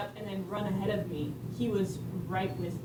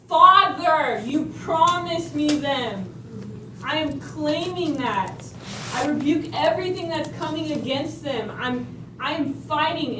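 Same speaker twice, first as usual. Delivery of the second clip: loud, higher, slowed warfare prayer; fervent prayer; 7 semitones higher, 9 dB louder than usual. Retold or imitated. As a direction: pray like you're claiming it with force. His prayers, they're not just.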